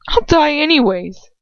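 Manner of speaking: high, loud, trembling plea; a girl's voice close to tears. A narrator's reading. Girl confronting her lover, high and trembling.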